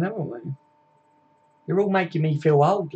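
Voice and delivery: ordinary talk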